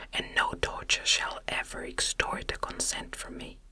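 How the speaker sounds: ordinary delivery, nothing marked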